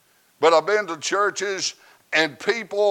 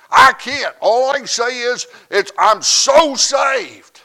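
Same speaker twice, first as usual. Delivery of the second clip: full-force preaching; 6 semitones higher, 8 dB louder than usual. Acted. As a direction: preach it out to the whole church.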